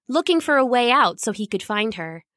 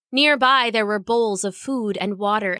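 ordinary delivery

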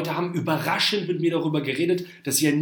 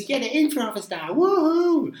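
much higher, cheering, with a loud whoop; mock joyful filter voice; 12 semitones higher, 4 dB louder than usual. Shown as a demonstration. Posting an Instagram filter video, overjoyed high cheering voice. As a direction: sell the vaccine news like a giddy cartoon. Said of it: "so eine freudige Stimme".

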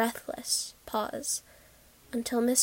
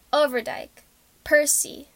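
raised, higher roll-call voice; calling out names; 3 semitones higher, 6 dB louder than usual. A narrator's reading. Lottery official summons the next men, calling out. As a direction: call each name out to the crowd.

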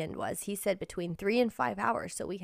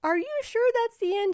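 much higher, disbelieving question; doubtful friend; 14 semitones higher than usual. Retold or imitated. Friend yelling up from behind, doubtful and high. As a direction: ask it like you refuse to believe it. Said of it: "Their friend yelled up".

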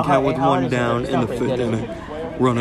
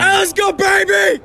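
cheering shout; 5 dB louder than usual. Real, not acted. Cheering teammate from dugout.

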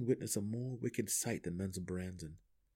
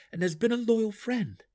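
high, louder, insistent comeback; sharp retort; 13 semitones higher, 5 dB louder than usual. A narrator's reading. Drizzt defends his loyal friend, sharp retort. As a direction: push the point sharply, pitch way up. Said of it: "Drizzt retorted sharply".

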